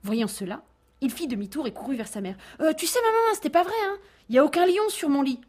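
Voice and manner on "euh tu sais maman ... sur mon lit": put-on high, loud little boy owning up with hesitant euhs; a small boy, embarrassed, taking back his lie; sheepish little boy. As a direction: petit garçon penaud, voix aiguë. Boy confesses the lion lie.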